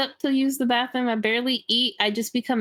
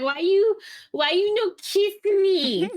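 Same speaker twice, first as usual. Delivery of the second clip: higher, slow pouty whine; pouty baby voice; 10 semitones higher, slower than usual. Retold or imitated. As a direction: sulk like a needy toddler. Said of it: "be all pouty and be like".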